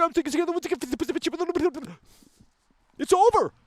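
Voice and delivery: ordinary talk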